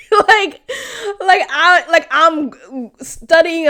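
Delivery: a real laugh breaking the words; incredulous laughing. Real, not acted. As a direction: laugh it out in disbelief. Laughing in disbelief at uncle.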